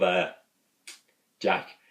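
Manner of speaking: ordinary delivery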